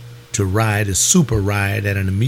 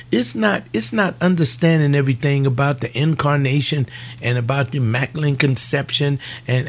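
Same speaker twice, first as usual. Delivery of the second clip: ordinary talk, nothing marked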